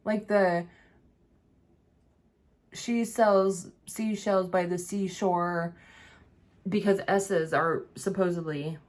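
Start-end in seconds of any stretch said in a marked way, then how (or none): 2.7-5.8 each word slow and deliberately enunciated; over-enunciate the "She sells seashells" slowly; slow careful tongue twister. Shown as a demonstration. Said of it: "they have you say tongue twisters".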